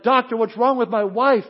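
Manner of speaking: acted husband's worried shout; anxious shout. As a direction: burst out, desperate for an answer. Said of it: "and shouted".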